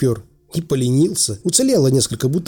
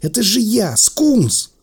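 high-pitched cry, climbing on the name; wailing; 9 semitones higher, slower than usual. Acted. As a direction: cry out who you are, pleading. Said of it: "завопило в кустах".

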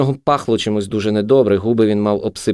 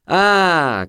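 high-pitched exclamation; raised, high jeer; cry; 9 semitones higher than usual. A narrator's reading. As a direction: cry it out derisively. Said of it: "крикнув шмаркач".

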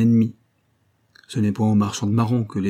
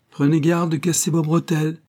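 higher mocking exclamation, a street urchin's jeer; mocking cry; 7 semitones higher than usual. A narrator's reading. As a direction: toss it out with cheeky scorn. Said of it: "s'écria-t-il, avec un inexprimable accent". (moquerie gouailleuse, plus aiguë)

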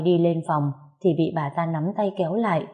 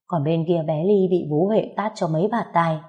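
usual speaking voice

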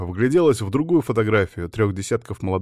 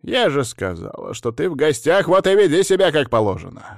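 forceful, power-filled voice; 6 semitones higher, 5 dB louder than usual. A narrator's reading. Woman rebuking her guest, forceful. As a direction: build to a high, loud command. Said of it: "напитав голос силой".

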